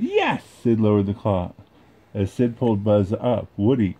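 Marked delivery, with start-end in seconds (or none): 0.0-0.4 very high triumphant squeal; gleeful yes. Acted. Sid wins the prize.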